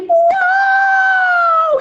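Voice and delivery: very high, loud howl of pain; long, piercing yowl; wolf's scalded howl. Acted. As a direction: shriek like a scalded cartoon wolf. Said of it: "Só se ouviu aquele".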